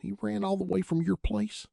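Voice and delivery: amazed question; unbelieving. A narrator's reading. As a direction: ask it in disbelief. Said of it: "Chris asked unbelievingly".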